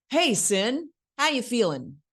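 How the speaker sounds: high, sing-out canned greeting; rote line reading. Shown as a demonstration. Acting coach mocks actors who memorize one way, canned high greeting. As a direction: deliver it as a pre-set, memorized tune. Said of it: "they memorize their lines a certain way".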